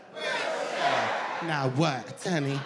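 normal talking voice

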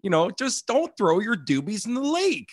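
high, exasperated mock pleading; holding back swearing. Cool uncle scolds guys at his cottage, holding back swearing. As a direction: imitate a fed-up uncle straining not to curse. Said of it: "he always wants to swear, but he doesn't".

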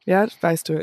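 ordinary talk

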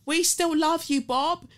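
high, loud mimicked heckle; mock someone yelling out from the audience; imitated obnoxious shout. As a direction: derisive re-enacted crowd shout. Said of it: "shout like a prick".

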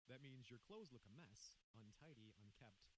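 usual speaking voice

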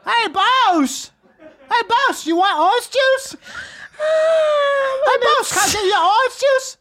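very high, loud goofy holler; mock over-eager guy yelling a greeting; random guy calling out. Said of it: "some guy called, said Bruce once".

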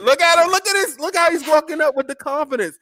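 high, excited play-by-play. Real, not acted. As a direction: hype it up like ringside commentary. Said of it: "I'm just too excited".

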